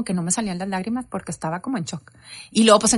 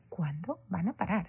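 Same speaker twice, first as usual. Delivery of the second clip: soft, slow murmur to herself; quiet despair; 2 semitones lower, 11 dB quieter than usual. Retold or imitated. Mother recalling endless tears, quiet helpless wondering. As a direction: ask it slowly, barely voiced. Retold helpless thought while crying nonstop.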